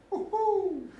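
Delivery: happy little high-pitched whoop; gleeful whoop. Acted. Happy man skipping along at morning, gleeful whoop. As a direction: let out a skipping, happy woohoo.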